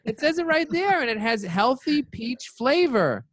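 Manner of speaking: high, whiny mock protest; mock defensive self. Arguing with his doctor about Snapple, high whiny protest. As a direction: whine it like a kid caught out.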